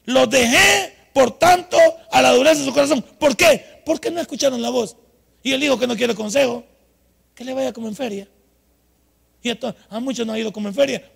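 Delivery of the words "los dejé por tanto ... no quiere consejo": loud, high declamation of scripture; forceful preaching. Acted. Preacher reading the verse to the congregation, forceful loud preaching. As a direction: hammer out the verse, then drive the point home.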